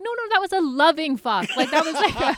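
loud, high, laughing excuse; laughing protest. Retold or imitated. Mom laughing off swearing at her son, loud and giggly. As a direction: laugh it off while defending yourself. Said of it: "I'm like".

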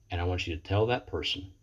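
normal talking voice

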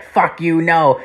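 lightly acted defiant retort; mock defiance. Suspect refusing police search, acted.

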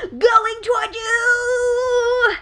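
slow booming mystic send-off; dramatic psychic shout. Pretend. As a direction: push it out like a stage psychic. Mock psychic sending image.

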